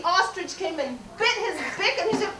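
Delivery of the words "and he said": usual speaking voice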